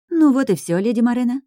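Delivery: bright, high finishing call; cheerful announcement. Acted. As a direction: announce it proudly, lifted up. Maid announces she is done.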